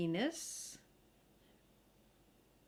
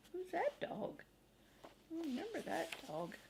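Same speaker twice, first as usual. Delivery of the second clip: hushed aside; 7 semitones higher, 8 dB quieter than usual. Real, not acted. Aside to child while reading.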